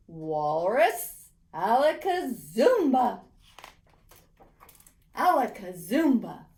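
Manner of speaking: loud, slow furious casting; angry spell shout. A narrator's reading. Insulted boy summoning a walrus, loud and angry. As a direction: summon it in a temper.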